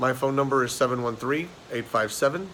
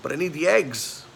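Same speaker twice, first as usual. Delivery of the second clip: higher, louder protesting patient; raised-voice comic protest; joke's patient; 5 semitones higher, 8 dB louder than usual. Acted. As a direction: deliver the punchline as the exasperated brother, pitched up. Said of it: "The guy says".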